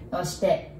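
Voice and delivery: ordinary talk, nothing marked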